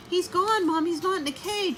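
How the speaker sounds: put-on high, urgent child's shout; shouting boy. Simon shouting to his mother about the missing hamster. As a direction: tell Mom the news in alarm. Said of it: "Simon shouted".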